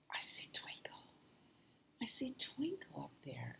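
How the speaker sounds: hushed whisper, almost no voice. Real, not acted. Storyteller telling a child at bedtime, whispering. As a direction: breathe it like a secret at bedtime. Bedtime secret about the star.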